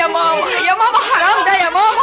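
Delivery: sobbing protest to mama; crying. Acted. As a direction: plead through tears. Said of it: "بتعيطي ليه يا ناديه".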